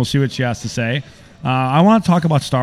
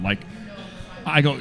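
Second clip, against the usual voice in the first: usual speaking voice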